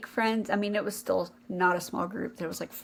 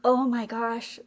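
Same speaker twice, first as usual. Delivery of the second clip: retold amazement; 2 semitones higher, 5 dB louder than usual. Retold thrilled reaction to invitation.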